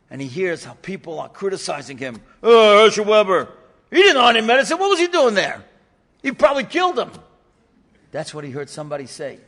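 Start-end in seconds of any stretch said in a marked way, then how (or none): 2.4-7.1 loud, sneering gossip from the crowd; scornful crowd. Retold or imitated. Bystanders outside mocking Herschel Weber, loud sneer. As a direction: jeer loudly like a nasty bystander.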